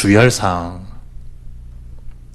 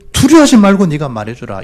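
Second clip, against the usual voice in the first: ordinary talk, nothing marked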